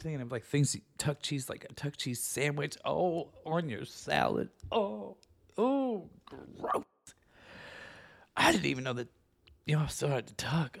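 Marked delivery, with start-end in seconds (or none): 4.7-6.8 high oh, oh, then a breathy gross. Real, not acted. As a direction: recoil in disgust.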